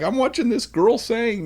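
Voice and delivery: high, choked-up mock reply to his wife; mock teary reply. Husband answering his wife while crying at a singer, high and choked. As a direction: squeak it out like he's fighting back tears.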